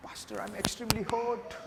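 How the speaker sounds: soft, slow, exaggerated self-pity; hammed-up sulking plea; mock wounded whine. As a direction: play up the hurt, quiet and dragged out. Mock congregant, more hurt.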